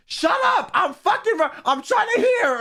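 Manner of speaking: imitating an annoyed fan shouting shut up at the crowd; angry audience member. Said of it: "someone else in the audience was like".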